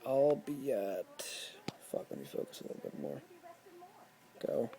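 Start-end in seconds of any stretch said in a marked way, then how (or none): none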